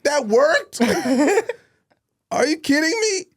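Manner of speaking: high, loud, laughing disbelief; can't believe it worked; amazed. Retold or imitated. Watching the organizer let them stay, amazed.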